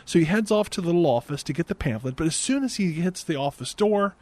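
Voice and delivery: ordinary delivery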